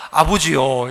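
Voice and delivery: stiff, respectful call of father, low; a stiff formal son addressing a strict father. Retold or imitated. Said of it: "전부 다 뭐라고 하냐면".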